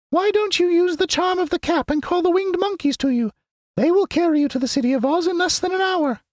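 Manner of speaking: very high squeaky mouse queen; squeaky little voice. A narrator's reading. Queen of the Mice greeting her friends, squeaky little voice. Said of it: "in her squeaky little voice".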